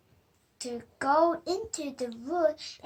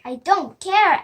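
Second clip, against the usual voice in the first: defiant; 3 semitones higher, 11 dB louder than usual. Acted. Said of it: "answered Sam".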